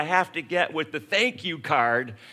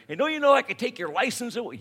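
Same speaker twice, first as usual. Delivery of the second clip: higher, fast, stern police lecture; fuming cop building; 8 semitones higher, faster than usual. Retold or imitated. Cop scolding the speeder, fast and higher. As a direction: pile it on like an angry cop.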